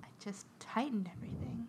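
baffled whisper; puzzled whisper. Retold or imitated. Lady in the dark whispers her confusion.

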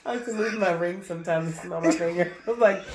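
ordinary delivery

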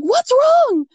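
high, loud retold alarm. Husband coming up to panicking wife, imitated alarm. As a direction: imitate someone rushing up worried, pitch jumping. Husband answers her cry for help.

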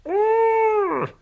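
very high, loud grossed-out urgh; high loud yuck noise; disgusted urgh cry. Acted. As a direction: let out a squealing urgh of disgust. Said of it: "cried Broccoli".

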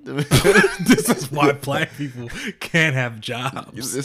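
slow words pushed through laughter; laughing drawn-out repeat. Real, not acted. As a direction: say it while cracking up. Repeating friend's joke laughing.

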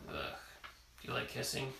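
plain reading voice

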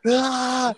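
sudden high yell at the deer; short, high, crazed yell; mock scream. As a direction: let out a wild, high shout to scare them off. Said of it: "started screaming at them".

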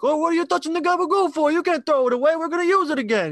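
put-on high, loud New York wiseguy accent, fast; hammed-up New York deli worker scolding; mock New York accent. Coworkers joking at the deli counter in a mock New York accent. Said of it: "especially talking in that accent".

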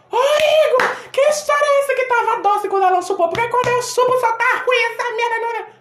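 high, loud accusing shout at Igor; angry confrontation. Retold or imitated. Ju confronting Igor over the sweet taste, angry high shout. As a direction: yell at him, outraged and jealous. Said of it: "Ju fez".

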